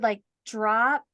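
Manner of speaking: normal talking voice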